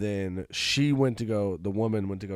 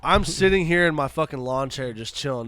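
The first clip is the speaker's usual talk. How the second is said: ordinary talk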